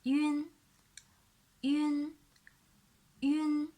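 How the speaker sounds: "yun" drilled for copying; clear demo syllable. Shown as a demonstration. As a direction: model "yun". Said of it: "u with an alveolar nasal".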